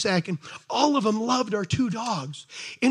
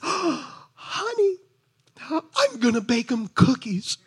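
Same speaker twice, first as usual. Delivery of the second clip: sweet wife; slower than usual. Retold or imitated. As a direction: lilt it sweetly like his wife. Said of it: "my wife is so sweet".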